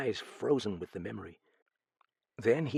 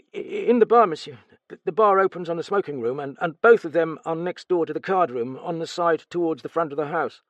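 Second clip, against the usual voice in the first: stammering, higher, louder frightened servant; nervous stammer; 7 semitones higher, 6 dB louder than usual. Acted. Steward questioned about the murder, nervous stammer. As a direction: stumble over the words in fright.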